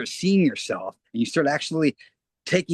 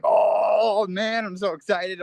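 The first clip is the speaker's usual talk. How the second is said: higher, brighter thrilled voice; excited; 8 semitones higher, slower than usual. Retold or imitated. Recalling childhood joy to the host, excited and higher. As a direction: gush like a kid with new video games. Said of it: "I'm like".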